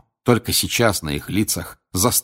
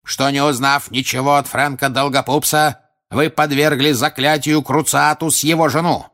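loud, higher stern accusation; straining his voice; 5 semitones higher, 4 dB louder than usual. A narrator's reading. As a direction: push every charge out at full voice. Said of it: "надрывал голос мистер Крауч".

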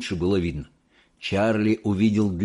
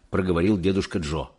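ordinary delivery, nothing marked

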